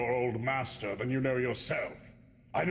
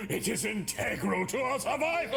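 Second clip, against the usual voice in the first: urgent shout; 9 semitones higher than usual. Acted. Urgent in-character demand.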